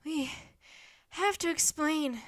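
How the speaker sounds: halting, out of breath from running; breathless. A narrator's reading. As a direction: break it up, catching breath. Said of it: "Jack said breathlessly".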